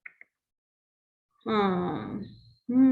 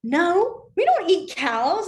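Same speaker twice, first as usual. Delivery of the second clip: put-on protesting kid; 6 semitones higher, 6 dB louder, much slower than usual. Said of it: "the kids will go".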